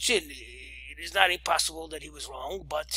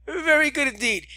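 laughing aftertaste; 8 semitones higher, 5 dB louder than usual. Acted. Still laughing, high.

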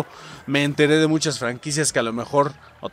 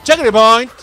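louder, higher call of the word; shouting checkpoint; 6 semitones higher, 8 dB louder than usual. Real, not acted. Reaching a checkpoint in the game, gleeful shout. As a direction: call it out with glee. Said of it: "Me gusta gritar checkpoint".

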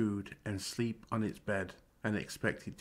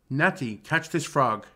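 loud, higher playful call across the room; cried out; 5 semitones higher, 10 dB louder than usual. Acted. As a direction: shout the prank at his sister. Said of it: "cried out".

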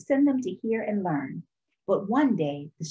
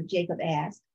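plain reading voice

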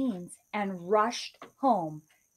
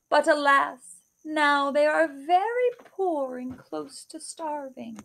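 put-on old woman; 6 semitones higher than usual. Old woman tells Kate of the giant, high kindly voice. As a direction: tell the sad tale gently as a grandmother.

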